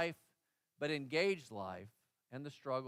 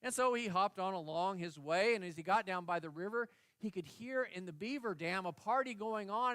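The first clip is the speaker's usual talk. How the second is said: normal talking voice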